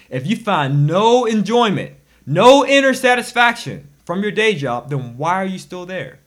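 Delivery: exasperated rant. Real, not acted. Scolding rant at unhappy workers.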